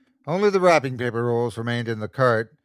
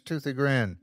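usual speaking voice

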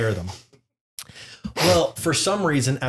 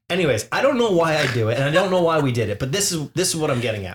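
normal talking voice

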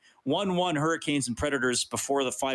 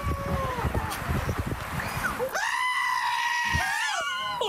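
shrieking laughter. Real, not acted. Host cracking up at goat sound.

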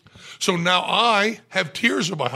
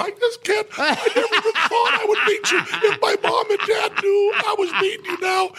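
mock sobbing gush, high and shouted; gushing crying fan. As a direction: blubber like a starstruck kid meeting his hero. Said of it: "And I went".

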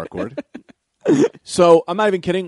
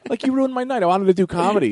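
high-pitched indignant complaint; furious; 8 semitones higher than usual. Real, not acted. Comic still sore about his ruined night, indignant. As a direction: gripe it out, pitched way up. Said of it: "I'm still furious".

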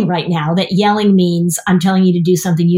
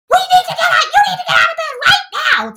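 parent yelling. Retold or imitated. Parent yelling at kid who won't get up, mock yell. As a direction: bark it out like a fed-up parent. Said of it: "you're like".

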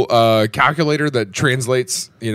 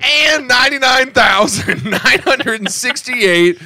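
high, shouted prize announcement; game show announcer; 11 semitones higher, 5 dB louder than usual. Pretend. Podcaster offering an absurd prize to listeners, mock announcer. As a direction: stretch it out like a hammed-up giveaway host.

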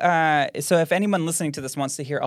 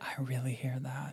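whisper; 14 dB quieter than usual. Real, not acted. Whispering after the recording.

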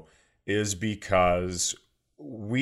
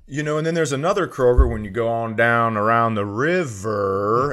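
Southern accent imitation; 5 dB louder than usual. Cohost mimics the host's slip into a Southern accent. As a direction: tease a friend by imitating his Southern accent. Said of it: "not to go into the Southern accent".

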